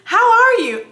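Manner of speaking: high, loud imitated greeting; happy doctor's greeting. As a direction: mimic a cheery doctor's question. Repeating the happy doctor's question.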